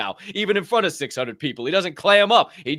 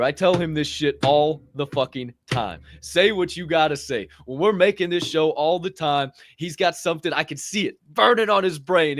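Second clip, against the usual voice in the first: normal talking voice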